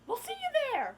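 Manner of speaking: high, loud, hammed-up enthusiasm; exaggerated squealed promise; mock excited reply. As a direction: call it out high and eager. Acting out the girls' keen reply.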